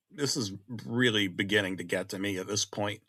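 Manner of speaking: ordinary delivery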